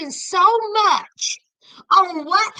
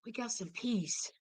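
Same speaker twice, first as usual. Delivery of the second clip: much lower and far softer, a relieved hush; relieved sigh; 9 semitones lower, 16 dB quieter than usual. Retold or imitated. Anthony alone in the car after leaving, hushed relief. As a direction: breathe it out like sweet relief. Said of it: "he was like".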